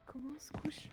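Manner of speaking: soft unvoiced question; whispering while hiding. Real, not acted. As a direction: whisper it, afraid to be heard.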